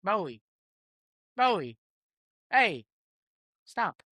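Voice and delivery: high-pitched call to a pet; sharp high call to stop the dog; high call to the dog Bowie to stop. Real, not acted. Calling the dog mid-podcast.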